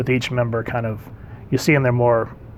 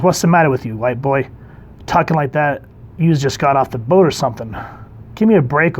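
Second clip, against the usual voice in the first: put-on loud, higher brassy Brooklyn girl; Brooklyn accent; 3 semitones higher, 5 dB louder than usual. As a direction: tough Brooklyn girl telling him off, louder and higher. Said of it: "did you start talking Brooklyn?".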